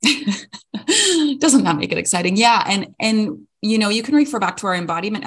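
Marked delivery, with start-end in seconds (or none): 0.0-2.4 slow, higher, laughing repeat; laughing echo. Real, not acted. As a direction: savor it through a laugh.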